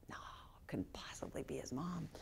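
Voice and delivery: hushed whisper to himself, barely voiced; whispered thought. Approaching the grave, telling himself it can't be her: hushed whisper. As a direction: whisper it as disbelief you can't quite allow.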